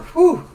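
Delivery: loud high whew of release; loud relieved whew; loud exhale. Real, not acted. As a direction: blow out a big breath after ranting. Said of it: "I got that a little crazy".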